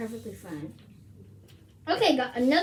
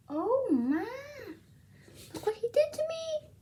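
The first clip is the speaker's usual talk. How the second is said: dismayed; 10 semitones higher than usual. Real, not acted. Showing off a cat scratch.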